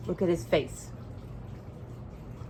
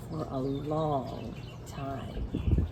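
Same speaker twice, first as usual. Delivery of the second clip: drowsy, slowed-down narration; slow sleepy drawl; 8 semitones lower, 9 dB quieter, much slower than usual. A narrator's reading. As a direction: stretch it out slowly, softer, like drifting off to sleep.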